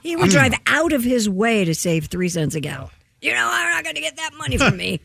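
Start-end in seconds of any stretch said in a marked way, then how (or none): 3.2-5.0 high, fast mock rant; imitating cranky stepfather. Daughter mimicking stepfather refusing pricey gas, mock-indignant. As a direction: play an indignant stepfather, pitched up and rushed.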